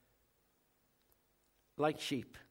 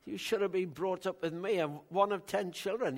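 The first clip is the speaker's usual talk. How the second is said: ordinary delivery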